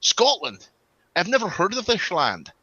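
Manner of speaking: mock incredulous, as if the land were unheard of; Sean Connery. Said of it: "Sean Connery, who's playing a Spanish man, goes".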